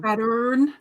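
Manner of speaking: Pattern with a slightly extra stress on the last R; extra R emphasis. Shown as a demonstration. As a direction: add a touch of R at the end of Pattern. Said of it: "ever so slightly extra emphasis on the".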